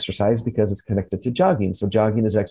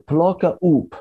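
plocka upp in a Swedish accent, plocka as PLO-ka; 7 semitones higher than usual. Shown as a demonstration. Host shows off his Swedish accent to Lil, Swedish accent. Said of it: "How'd you like that for my Swedish accent?".

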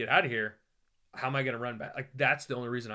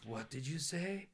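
stunned soldier; 3 semitones higher, 9 dB quieter, slower than usual. Retold or imitated. Soldier startled by the traveler's joke, slow soft disbelief. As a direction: let the question hang quietly.

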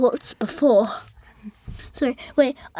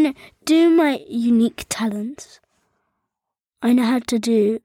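usual speaking voice

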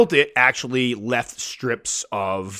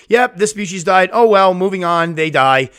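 louder, higher, breezy brush-off; mock dismissive keeper; 7 semitones higher, 8 dB louder than usual. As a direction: imitate a keeper shrugging off a death. Imitating people who don't care.

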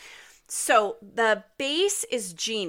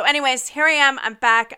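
bursting louder with a real thrill to be back; sudden real excitement, much louder; excited comeback; 5 semitones higher, 9 dB louder than usual. Real, not acted. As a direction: announce your return with a loud, happy burst. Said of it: "I'm super excited".

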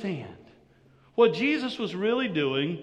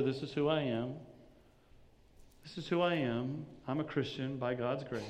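quiet inner reminder; 4 semitones lower, 7 dB quieter than usual. Retold or imitated. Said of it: "It was a reminder".

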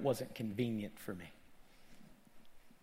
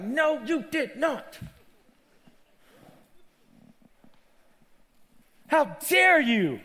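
high, loud outrage with drawn-out stresses; retold anger; 13 semitones higher, 12 dB louder than usual. Preacher scolding the ruler over the crippled woman, angry shout. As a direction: shout it slowly in indignation. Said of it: "I was literally getting angry".

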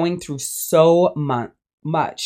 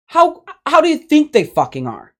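exasperated; 10 semitones higher than usual. Real, not acted. Exasperated rhetorical follow-up.

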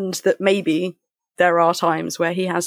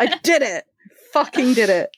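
laughing, pitched up in triumph; triumphant laugh; 6 semitones higher than usual. Real, not acted. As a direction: crow about pulling it off. Triumphant after finishing a point.

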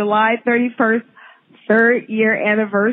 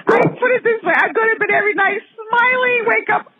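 giddy laughing; 11 semitones higher than usual. Real, not acted. Giddy confession about Governor Cuomo.

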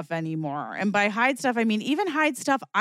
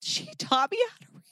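choked-up crying. Retold or imitated. Crying girl thanking her teacher at her bat mitzvah, mock choked-up. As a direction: imitate sobbing through a closing throat. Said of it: "can't talk through it 'cause my throat closes".

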